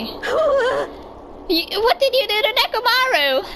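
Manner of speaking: put-on a worried girl demanding answers; alarmed girl voice.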